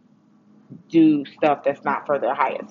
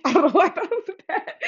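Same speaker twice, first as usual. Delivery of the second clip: high, fast laugh-through; words bubbling with real laughter; 14 semitones higher, much faster than usual. Real, not acted. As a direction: giggle at your own silliness. Laughing at own mistake.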